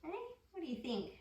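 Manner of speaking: plain reading voice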